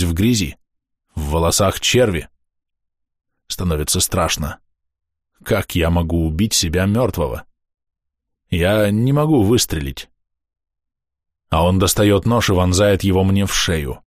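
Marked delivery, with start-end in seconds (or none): none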